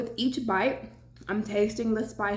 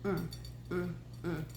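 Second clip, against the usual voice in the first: appreciative mm while chewing; 3 semitones lower, 9 dB quieter than usual. Real, not acted. Tasting food in mukbang.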